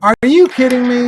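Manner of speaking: loud, high disbelief on you; shocked outburst, stress on you; incredulous. Real, not acted. Disbelief at app failing.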